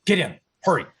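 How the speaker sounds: retold urgent shout; urgent order. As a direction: call it out like a cabbie rushing passengers in. Retold cabbie urgently orders them in.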